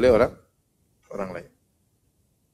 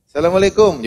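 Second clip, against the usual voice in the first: hearty greeting; 6 semitones higher, 5 dB louder than usual. Acted. Greeting again.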